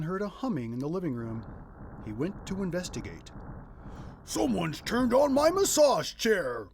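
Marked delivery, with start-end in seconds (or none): 4.2-6.7 loud, slow bellow, pitched higher; father bellowing about his massage chair. A narrator's reading. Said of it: "he bellowed".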